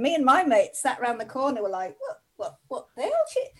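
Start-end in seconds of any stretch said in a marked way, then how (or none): 2.0-3.5 high, hushed bafflement; puzzled, softly squeaked questions; baffled quote. Retold or imitated. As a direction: act out bewildered mates, high and small. Mates puzzled by early cheer.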